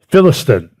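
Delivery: ordinary delivery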